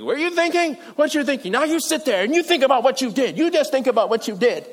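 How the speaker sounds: high, loud telling-off from an exasperated parent; imitated parent laying down the law; scolding parent. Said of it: "like what your parents see".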